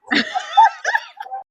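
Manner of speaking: high burst of laughter. Real, not acted. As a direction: crack up laughing. Laughs at own story.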